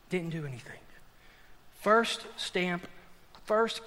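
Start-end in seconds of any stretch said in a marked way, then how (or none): 0.1-0.8 hushed repeat. Shown as a demonstration.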